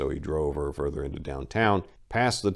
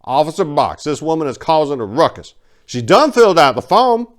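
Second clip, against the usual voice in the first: high, loud complaining clerk; irate clerk; 10 semitones higher, 12 dB louder than usual. Acted. Irate clerk complaining to Officer Box, loud and high. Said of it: "the irate clerk".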